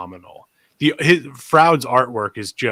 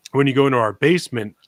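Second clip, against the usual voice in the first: ordinary talk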